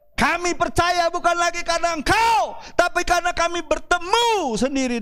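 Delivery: high, ringing declaration; emphatic proclamation. Acted. Samaritans telling the woman they met the Messiah, proclaimed emphatically. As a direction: proclaim it high and forcefully to the crowd.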